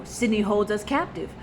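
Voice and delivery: ordinary talk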